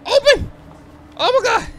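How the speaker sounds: high panicked scream; screaming in fear. Real, not acted. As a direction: shriek at the door in terror. Door won't open, game chase.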